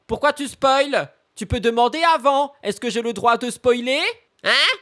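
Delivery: mock scolding. Scolding a chat spoiler.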